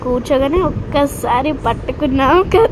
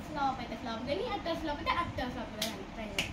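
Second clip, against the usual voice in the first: fast hushed whisper; whispering while hiding; 17 dB quieter, faster than usual. Real, not acted. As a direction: whisper the plan quickly. Hiding, plotting the prank.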